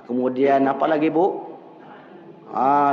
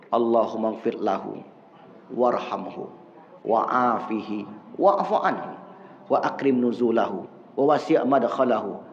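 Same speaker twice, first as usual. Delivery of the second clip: low, soft Arabic prayer chant; prayer recitation; 7 semitones lower, 3 dB quieter than usual. Shown as a demonstration. Preacher recites the funeral prayer, low and soft.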